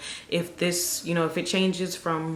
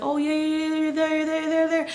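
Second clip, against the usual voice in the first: high-pitched mock encouragement; high, singsong cheerleading; encouraging delivery staff; 10 semitones higher than usual. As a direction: imitate staff chanting her on in a high, sustained pitch. Acting staff cheering her on.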